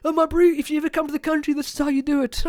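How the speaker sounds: a hint of a chummy local showing a visitor; South African slang voice. Answering the tourist in the viral video, in a chummy local bro voice. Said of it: "it's like".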